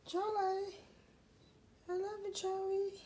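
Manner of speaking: high-pitched affectionate coo; doting pet-talk. Real, not acted. Owner telling pet Charlie of love, high doting coo.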